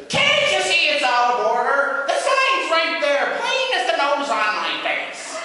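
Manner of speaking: high, loud, slow cranky gatekeeper; Oz doorkeeper. Acted. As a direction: scold them from the peephole. Oz doorman scolding Joseph.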